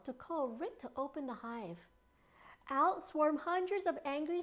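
plain reading voice

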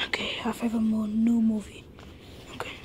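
hushed secret whisper. Acted. Kid whispers to friend about a new movie, hushed. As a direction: keep it secret, breathe it.

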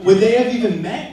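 loud, high rhetorical rise on they; big rhetorical question. Real, not acted. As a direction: throw the question out to the room, rising. Rhetorical question in toast, repeated.

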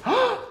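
high, sharp gasp of wonder; hammed-up storyteller gasp; amazed gasp. Shown as a demonstration. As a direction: gasp as if astonished for a child. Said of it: "Y entonces dices".

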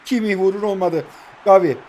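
ordinary talk

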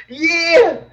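a high, whimpering fake cry; mock sob. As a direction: squeeze out a pretend sob. Ends the mock crying bit.

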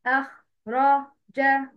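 "أخ، را، جا" syllable by syllable with pauses; syllabic spelling. Shown as a demonstration. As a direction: give "أخ", "را", "جا" each its own slow beat. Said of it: "ده كده تهجي مقطعي". (نطق "أخ، را، جا" مقطعًا مقطعًا)

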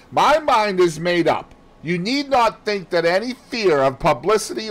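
high, loud, firm and determined; wife refusing to back down, loud and high; resolute mistress. Retold or imitated. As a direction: lay down her decision coldly. Mistress continues the quarrel.

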